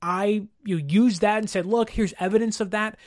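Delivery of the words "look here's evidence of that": ordinary delivery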